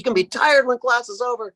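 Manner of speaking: high-pitched mock coaching yell; imitated drill instructor. Martial arts instructor mimicking class motivation, high mock yell. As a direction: push the class on, hammed up. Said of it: "the narrative is so often".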